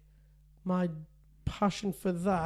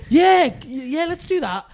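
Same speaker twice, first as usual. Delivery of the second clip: higher and louder, giddy agreement; eager yes; 7 semitones higher, 10 dB louder, slower than usual. Retold or imitated. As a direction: jump at the idea like a kid. Dad tempted by biscuit plot.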